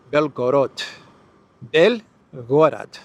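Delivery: Belgorod retried with a rolled, Russian-style ending; corrected Belgorod. Shown as a demonstration. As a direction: Belgorod drawn out slowly, closer to the Russian. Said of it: "I said it with a very American accent".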